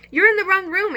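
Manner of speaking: high, loud little squeak of alarm; squeaky hamster. Acted. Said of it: "I squeaked".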